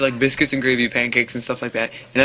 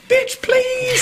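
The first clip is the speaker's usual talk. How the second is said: much higher, mocking sassy "bitch, please". Describing the restaurant posse to friends, mocking sass. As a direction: imitate a sassy diner crowd with attitude.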